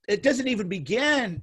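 ordinary talk, nothing marked

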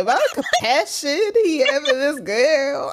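high, laughing mimicry of a gushing friend; teasing imitation. Imitating the co-host on his compassion, high laughing mimicry. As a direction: mimic her swooning while cracking up. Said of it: "I can't even do it".

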